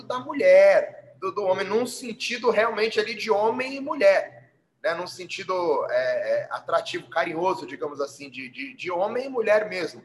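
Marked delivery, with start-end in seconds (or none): none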